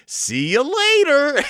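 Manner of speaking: high, loud breezy brush-off, slow. Retold or imitated. Missus McBear brushing off Papa Bear, breezy high. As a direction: sing it out while driving off. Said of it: "Missus McBear is all".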